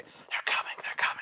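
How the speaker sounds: hushed, breathy urgent whisper; playful hiding whisper; mock whisper. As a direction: whisper like hiding for a surprise party. Surprise party hiding whisper.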